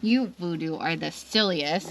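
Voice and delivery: ordinary delivery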